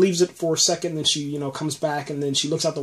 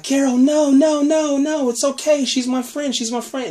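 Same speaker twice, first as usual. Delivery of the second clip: put-on high, pleading girl voice; pleading child; 11 semitones higher, 3 dB louder than usual. Lizzie begging Carol to spare her walker friend, high and pleading. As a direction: beg her to stop.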